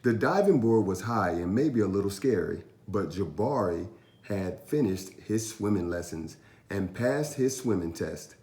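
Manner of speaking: ordinary delivery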